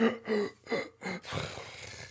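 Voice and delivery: high airy gasp for breath; mock rasping gasp; imitated gasping breath. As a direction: mimic his labored breathing. Imitating kid's heavy breathing.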